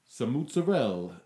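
MOZZARELLA as "mootsarell", last vowel dropped; imitating mother's dialect. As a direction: mimic mom saying "mozzarella" with an OO and no final A. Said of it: "my mother goes".

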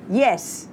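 mildly emphatic "Yes". A narrator's reading. Son backs his twin sister, emphatic on "Yes". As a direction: land "Yes" with firm emphasis. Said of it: "Nat said emphatically".

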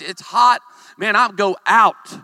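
ordinary talk

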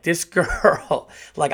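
ordinary talk, nothing marked

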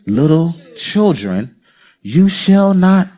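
slow, drawn out; slow scripture reading. Shown as a demonstration. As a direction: stretch every word of the verse. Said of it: "catch it".

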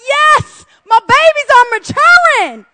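high, loud, beaming proud-parent voice; a hint of a delighted heavenly father; proud father God. God pleased his children grow up, proud and high. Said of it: "I think he's like".

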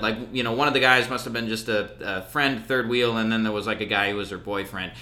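normal talking voice

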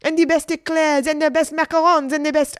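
high, loud insistent list; outraged boasting. A narrator's reading. Waitress boasting to Margaret until cut off, outraged shouting. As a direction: rattle off each boast indignantly. Offended waitress lists her pastries.

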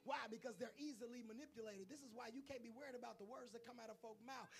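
ordinary delivery

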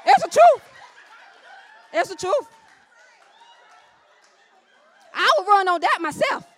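loud, high exhortation; full-force preaching. Real, not acted. Preacher testifying to the congregation, shouted preaching.

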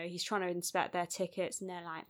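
ordinary talk, nothing marked

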